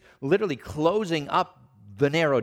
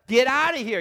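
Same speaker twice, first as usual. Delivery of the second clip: higher, louder stern order; stern dismissal; 8 semitones higher, 3 dB louder than usual. Acted. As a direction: drive them out with force. Jesus sending them away.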